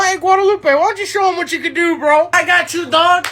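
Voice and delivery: ordinary talk, nothing marked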